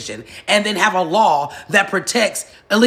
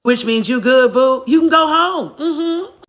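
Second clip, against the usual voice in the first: mocking send-off; 6 semitones higher, 6 dB louder, slower than usual. Real, not acted. Mocking the deported migrants.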